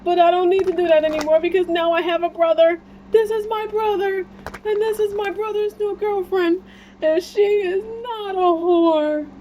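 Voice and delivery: proud comic character introducing his brother, very high; quoting a movie character. Retold or imitated. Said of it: "He was like".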